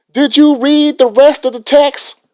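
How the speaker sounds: mock indignant question. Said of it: "He was like".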